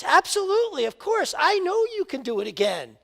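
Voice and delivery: high, loud gushing enthusiasm; eager fan. Acted. Spectator cheering on the tightrope walker, high and gushing. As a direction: gush like a starstruck fan.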